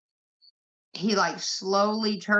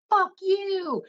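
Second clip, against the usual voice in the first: much higher, louder mock yell; retold scream; 12 semitones higher, 3 dB louder than usual. Dad screaming at a honking bus driver, loud mock yell. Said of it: "cussing and screaming at these bus drivers".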